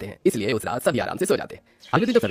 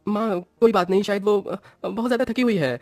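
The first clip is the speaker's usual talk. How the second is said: much higher put-on voice, faltering and unsure; hesitant husband; 9 semitones higher than usual. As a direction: stammer it out like an awkward young husband. Husband Kartik voiced by narrator. (झिझकता हुआ पति, ऊँची बनावटी आवाज़)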